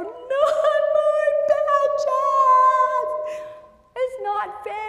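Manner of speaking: high, loud, drawn-out mock wail; hammed-up child tantrum. Child losing all the badges, mock tantrum. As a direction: wail like a screaming kid. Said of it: "they're gonna kick and scream".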